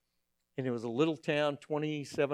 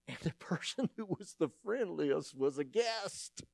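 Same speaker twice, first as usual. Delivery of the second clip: laughing; 3 semitones higher, 7 dB quieter than usual. Real, not acted. Laughing at the irony.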